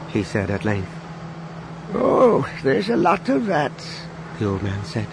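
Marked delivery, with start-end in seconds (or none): 1.9-5.1 put-on old man voice.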